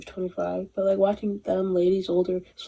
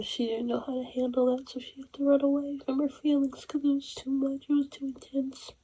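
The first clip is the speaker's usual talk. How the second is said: hushed sympathy; 6 semitones higher, 7 dB quieter than usual. Real, not acted. Soft retelling.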